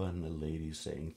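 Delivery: plain reading voice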